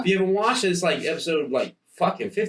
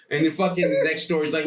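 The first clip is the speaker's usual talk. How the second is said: normal talking voice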